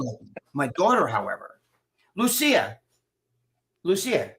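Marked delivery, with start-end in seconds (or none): 2.2-4.3 higher-pitched summons by name; calling out. Real, not acted. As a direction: call your daughter over. Calling daughter to come over.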